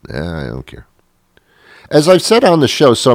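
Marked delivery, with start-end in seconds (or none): 0.1-0.9 soft, low throwaway mumble; quiet don't-care shrug; dismissive shrug. Pretend. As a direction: let it drop like it's nothing. Relieved dismissal.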